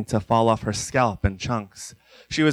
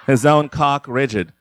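plain reading voice

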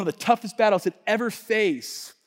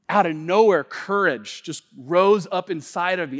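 ordinary talk, nothing marked

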